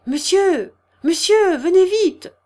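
urgent high, loud call. A narrator's reading. Nurse summons the husband from upstairs, calling urgently. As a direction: call down from the top of the stairs. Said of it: "la voix de la garde retentit en haut de l'escalier".

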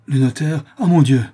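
dawning horror breaking into an exclamation; horrified realisation. A narrator's reading. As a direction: gasp it out in shock. Artisan learns the notary jails him.

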